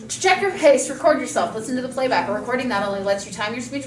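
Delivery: rapid timed read-through; fast reading. Shown as a demonstration. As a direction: read it briskly against the clock. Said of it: "Thirty-five seconds".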